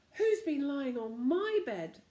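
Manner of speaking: slow, loud, growly demand; growly father bear. A narrator's reading. Father bear grumbling about his bed, slow and growly. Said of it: "in a growly voice".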